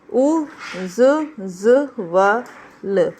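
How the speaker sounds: very slow, letter by letter; spelling out. Shown as a demonstration. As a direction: isolate each letter with a pause after it. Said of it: "कैसे लिखेंगे". (अक्षर-अक्षर धीरे बोलें)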